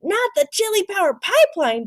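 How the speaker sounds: high, firm declaration; declared. A narrator's reading. Scipito declares against the pipeline, firm. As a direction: protest it with conviction. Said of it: "declared Scipito".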